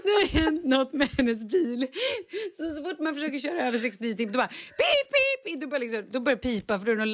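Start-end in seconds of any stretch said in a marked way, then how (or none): 0.0-4.3 laughing through the words; skrattande. Real, not acted. Skrattar medan hon berättar. 4.3-5.6 much higher, slow imitated beep; härmat pip.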